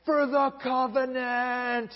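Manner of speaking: high, slow war cry; rally the faithful to fight; rallying battle cry. Retold or imitated. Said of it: "Mattathias is like".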